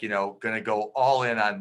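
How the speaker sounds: normal talking voice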